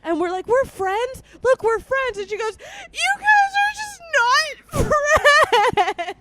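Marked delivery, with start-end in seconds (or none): 0.5-2.2 high, loud, insistent mock cheer; placating. Two rivals showing a crying friend they're friends, mock placating. 2.9-6.2 high, loud, very drawn-out imitated bawling; mock crying wail. Drunk friend accusing the two, hammed-up crying wail. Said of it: "And she's just crying".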